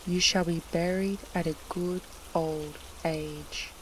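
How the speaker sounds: usual speaking voice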